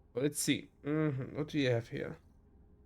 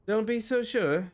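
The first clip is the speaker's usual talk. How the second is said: higher, slow attempt at Scottish; makeshift Scottish accent; 7 semitones higher, slower than usual. Elma doubts the newcomer, in a put-on Scottish accent. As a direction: retake it in a rough Scottish accent. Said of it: "whatever Scottish thing I had before".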